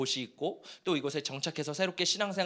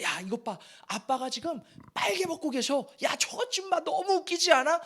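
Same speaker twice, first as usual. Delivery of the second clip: acted jeer climbing higher toward the end; mocking son; 8 semitones higher than usual. Preacher acting Ham mocking his naked father, jeering. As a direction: taunt a brother about your drunk father.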